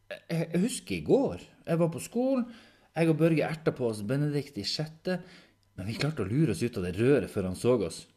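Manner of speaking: put-on high, loud, insistent young character; defensive Henrik. Henrik recounting yesterday at school, defensive and eager.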